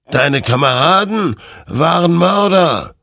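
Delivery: loud, high spectral proclamation; thundering accusation. Acted. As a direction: hurl the verdict out, stretching the end. (laut und anklagend verkündend)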